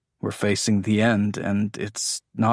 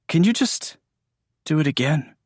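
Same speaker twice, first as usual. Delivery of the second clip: pitched up, asking for more; eager plea; 7 semitones higher, 3 dB louder than usual. Acted. Andrew begs Jameson for another kiss, pitch jumping up. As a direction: jump up high on just.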